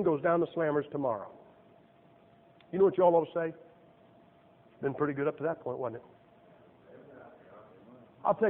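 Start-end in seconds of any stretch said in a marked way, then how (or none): 3.5-6.0 soft, slow, satisfied voice; contented reflection. Pretend. Preacher voicing what the congregation should say, soft and slow. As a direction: savor it, lowered and easy.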